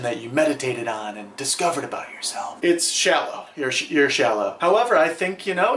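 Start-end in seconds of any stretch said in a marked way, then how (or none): none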